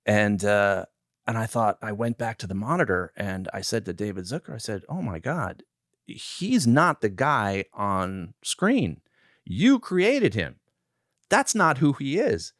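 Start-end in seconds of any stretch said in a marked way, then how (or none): 4.9-12.5 hammed-up indignant rant at the director, rising; mock outraged rant. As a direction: pretend outrage, climbing high by the end. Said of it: "I didn't say that".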